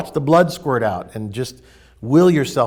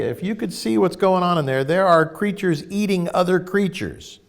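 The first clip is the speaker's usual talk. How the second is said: ordinary talk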